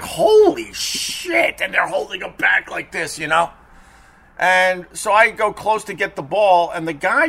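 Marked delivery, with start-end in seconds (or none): 0.0-1.6 drawn-out, much higher gasp of shock; shocked holy shit. Retold or imitated. Retelling meeting the snarling dog, stunned slow high exclaim. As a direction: stretch it slowly, high and stunned. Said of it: "And I'm like".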